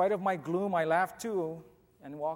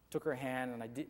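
usual speaking voice

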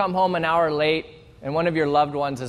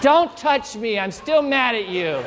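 mock pouty refusal; 6 semitones higher than usual. Partner rebuffs, comic punchline.

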